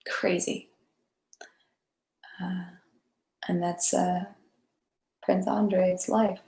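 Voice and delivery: breathy whisper to herself; soft awed whisper; whispered aside. Real, not acted. Muttering to herself after the reading.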